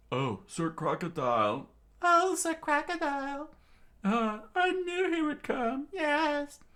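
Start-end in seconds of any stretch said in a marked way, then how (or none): 2.0-6.6 very high falsetto swoon; high, hammed-up admirer; adoring woman. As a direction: gush like a smitten fan in falsetto. Adoring woman greets her hero.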